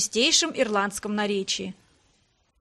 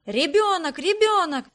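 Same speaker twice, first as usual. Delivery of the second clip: high, louder crowd cries; energetic crying out; 5 semitones higher, 4 dB louder than usual. A narrator's reading. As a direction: call out insistently, high and strong. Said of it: "закричали ещё энергичнее". (энергично выкрикивая)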